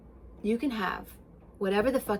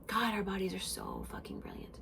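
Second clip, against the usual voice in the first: hushed whisper of wonder; awed whisper; 9 dB quieter than usual. Real, not acted. Admiring how clever bodies are, whispering in awe. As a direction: marvel under your breath.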